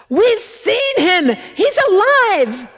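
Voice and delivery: high, loud cry of joy; joyful shout. Retold or imitated. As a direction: burst in shouting the good news. Peter bursts in with news.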